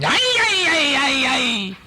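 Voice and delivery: high squealing monster cries; high-pitched ay ay ay from a picture-book monster; monster cry. Pretend. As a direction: squeal it out like a startled little monster. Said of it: "and he's going".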